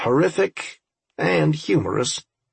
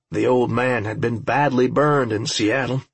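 ordinary delivery

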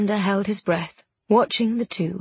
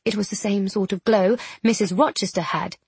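usual speaking voice

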